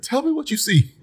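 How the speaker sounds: very high-pitched anxious plea; comic alarm, replayed high; retold urgent demand. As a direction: act out a panicked demand, pitch way up. Acts out urgent demand to Whitney.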